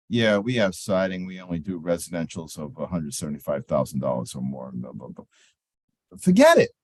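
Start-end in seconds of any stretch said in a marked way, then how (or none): 0.1-5.2 lower, flat recited sales line, no interest; bored, disengaged. Shown as a demonstration. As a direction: rattle it off like you don't care. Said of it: "not engaged with you in my tone of voice".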